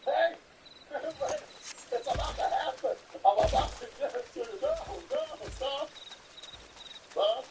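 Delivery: high, hammed-up imitation of panicked shouts; mock panicked yelling. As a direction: parody the screaming guy, mockingly. Mocking imitation of the victim.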